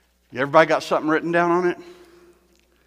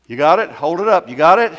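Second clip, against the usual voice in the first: ordinary talk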